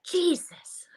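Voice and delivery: whispered Jesus under the breath; exasperated whisper. Real, not acted. Fed up with being called upstate, a whispered Jesus.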